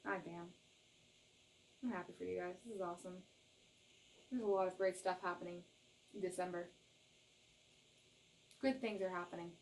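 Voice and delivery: quiet. Real, not acted. Reader softly happy for viewers. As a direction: soften down, pleased.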